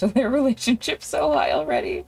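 ordinary talk, nothing marked

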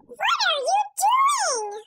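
squeaky high animal voice; a small animal asking, high and curious; high character voice. Acted. Farmer's voice? animal character asks.

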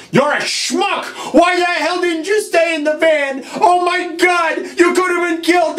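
high, exasperated yelling; furious scolding. Acted. Plumber berating his brother for risking his life, furious yell. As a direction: explode at him, pitch way up.

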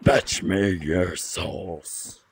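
very low, very slow monster growl; deep demon voice. Pretend. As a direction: drag it out like a demon. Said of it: "weakest impression I've heard".

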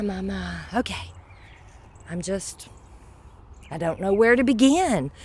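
normal talking voice

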